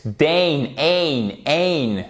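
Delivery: DANE, AIN, AIN as separate falling beats; isolated vowel drill. Shown as a demonstration. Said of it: "dangerous et pas dangerous".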